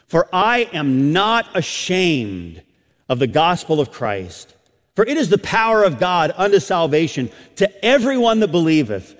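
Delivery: high, emphatic preacher's delivery; impassioned preaching. Real, not acted. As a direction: drive each phrase home from the pulpit. Preaches at full force.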